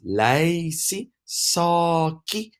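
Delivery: "lacy, saki" with drawn-out first vowels, pitched high; long vowels. Shown as a demonstration. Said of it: "Normal vowels or long vowels".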